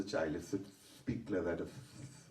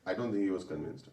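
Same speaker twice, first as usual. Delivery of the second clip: ordinary delivery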